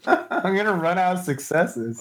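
high, laughing words. Real, not acted. Player reacting to a lucky roll, laughing. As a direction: say it through laughter.

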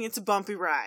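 ordinary talk, nothing marked

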